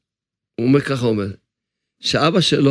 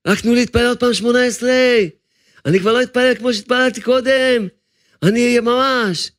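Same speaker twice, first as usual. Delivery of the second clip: mild cry from the soul, higher throughout; the pleading soul; 7 semitones higher than usual. Acted. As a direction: beg for one more prayer, a touch raised. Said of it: "תצעק". (נשמה צועקת ומתחננת, בקול גבוה)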